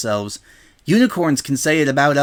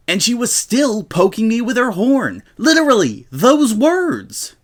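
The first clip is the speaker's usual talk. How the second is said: higher and louder, rising in protest; indignant outburst; 7 semitones higher, 4 dB louder than usual. Acted. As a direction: build from annoyed to outraged. Rainbow indignantly recounts the insult.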